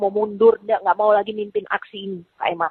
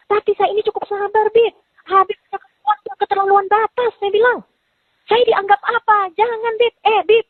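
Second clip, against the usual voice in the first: high-pitched retold scolding; 12 semitones higher than usual. Retold angry quarrel with Habib.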